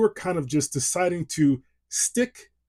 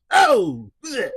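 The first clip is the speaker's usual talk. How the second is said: high, loud hammed-up Italian-American accent; over-the-top Italian wiseguy voice, played for laughs; terrible Italian accent; 4 semitones higher, 4 dB louder than usual. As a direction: go broad New York Italian, much higher and louder. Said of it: "my terrible Italian impersonation".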